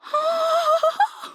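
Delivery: high sharp gasp of wonder; sudden delighted gasp; surprised gasp. Acted. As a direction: catch your breath in surprise. Feels the magic working.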